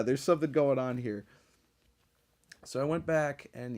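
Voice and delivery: usual speaking voice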